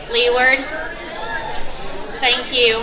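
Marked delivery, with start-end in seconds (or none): 1.4-1.9 psst. Real, not acted. Hushing the crowd for attention.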